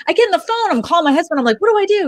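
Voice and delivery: high, panicked rush to her husband. Retold or imitated. Calling her husband in a panic, high and frantic.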